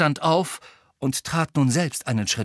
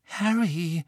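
higher, anxious address; rather fearful; 6 semitones higher than usual. A narrator's reading. Hermine appeals to Harry, rather fearfully. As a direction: say the name with timid worry. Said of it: "mit ziemlich ängstlicher Stimme".

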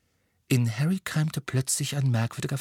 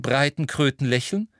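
plain reading voice

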